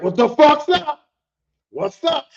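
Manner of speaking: high, loud callout; confrontational shout. Retold or imitated. Coworker confronts his rival outside the gate, confrontational. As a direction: call him out, spoiling for a fight.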